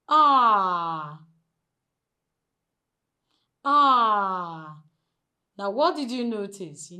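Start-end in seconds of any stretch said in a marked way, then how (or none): 0.1-4.8 "Ah" held long and louder; open, stretched "Ah" with lowered jaw; long stressed vowel. Shown as a demonstration. Teacher shows how the jaw drops, long open vowel. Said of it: "take a look at this".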